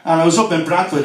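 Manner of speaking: ordinary talk, nothing marked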